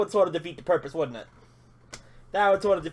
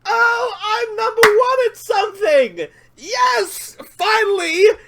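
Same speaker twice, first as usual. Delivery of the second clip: much higher, louder victory whoops, slowing on the big lines; ecstatic cheering; 10 semitones higher, 9 dB louder, slower than usual. Real, not acted. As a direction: shout with real joy at finally winning. Tops the daily rank.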